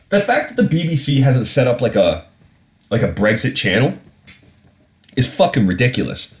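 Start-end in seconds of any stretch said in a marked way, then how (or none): none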